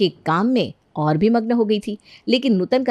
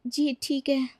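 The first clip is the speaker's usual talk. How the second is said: soft, higher-pitched submissive reply; meek obedience; 6 semitones higher, 8 dB quieter, slower than usual. Acted. Maid accepting another chore from her mistress, meek. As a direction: give in timidly and quietly. Meek servant takes an order.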